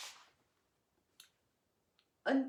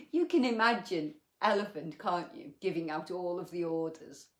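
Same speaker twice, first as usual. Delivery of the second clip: laughing; 6 dB louder than usual. Real, not acted. Laughing at elephant's orders.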